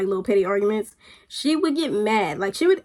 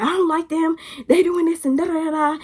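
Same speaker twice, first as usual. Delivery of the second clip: higher-pitched mocking imitation of a griping friend; retold petty complaint; 6 semitones higher than usual. Friend complaining about someone she dislikes, mock griping. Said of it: "she would get mad".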